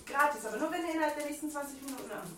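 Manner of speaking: rapid teleshopping urgency; fast sales pitch. Pretend. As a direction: rush the fine print.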